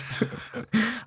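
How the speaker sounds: quiet breathy chuckle; a muffled giggle under the breath; soft laugh. Real, not acted. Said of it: "I shouldn't laugh".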